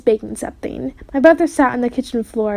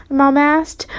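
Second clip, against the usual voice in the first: ordinary delivery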